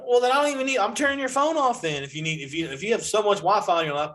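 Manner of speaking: high, mock-stern threat; a dad fed up with his daughter; exasperated dad threat. Dad threatening to shut off daughter's phone, exasperated.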